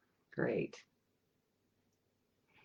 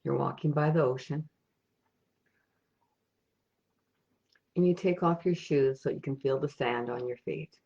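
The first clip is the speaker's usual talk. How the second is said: soft, unhurried meditation voice with long pauses; guided meditation; 6 dB quieter than usual. Acted. Meditation leader guiding listeners on an imagined ocean walk, soft and calm. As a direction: lead listeners gently inward, leaving space after each thought.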